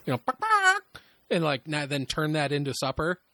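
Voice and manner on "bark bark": very high squawked bark, bark; high comic chicken squawk; chicken noise. Retold or imitated. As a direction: squawk like a hen. Imitating a chicken.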